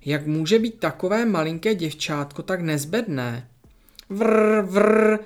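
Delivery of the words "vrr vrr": loud playful engine rumble; engine vroom. Pretend. Masha pretending to drive the ambulance, loud vrr. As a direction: rev it up like a toy car.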